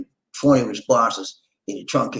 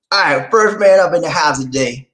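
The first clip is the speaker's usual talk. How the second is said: loud, high announcer call; emcee announcement; 6 semitones higher, 6 dB louder than usual. Acted. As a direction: hype up the first caller like a host.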